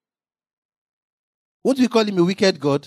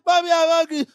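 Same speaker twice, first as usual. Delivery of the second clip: put-on high whiny child begging mommy; hungry child; 7 semitones higher than usual. A child whining to mommy for food. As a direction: play the little child tugging at mother. Child voiced crying to mother.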